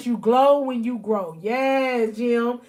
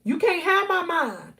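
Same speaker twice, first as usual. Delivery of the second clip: firm, higher, defiant no to the enemy; a touch of defiance, pitched up; defiant refusal; 5 semitones higher than usual. Pretend. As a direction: shut the enemy out, raised and firm. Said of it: "You gotta be like".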